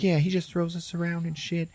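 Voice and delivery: lightly voiced high character; complaining food. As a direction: pipe up with a complaint. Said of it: "And like".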